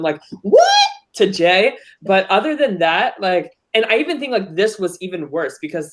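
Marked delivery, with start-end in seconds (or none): 0.4-0.7 high, loud mock disbelief; imitated outrage. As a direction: imitate an indignant reaction, pitched up. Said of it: "Millennials versus Gen X going".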